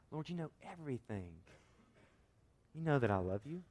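hushed, mildly hurt reply; grieved. Acted. Peter answering Jesus's third question, quietly grieved. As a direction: answer softly, with a touch of hurt. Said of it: "Peter was grieved".

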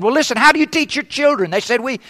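usual speaking voice

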